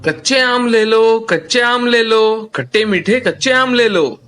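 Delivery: high, slow sing-song street cry; vendor's call. Acted. Ram calls to market crowd, slow hawker's cry.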